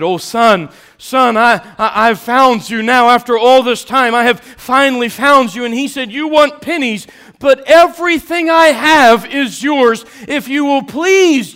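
loud, high pleading shout; pleading father's cry. Acted. Father finding his begging son, pleading loudly.

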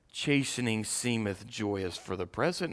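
normal talking voice

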